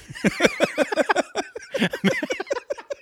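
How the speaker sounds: long high-pitched laughing fit; helpless giggle. Real, not acted. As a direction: crack up and keep giggling. Cracks up at mangled name.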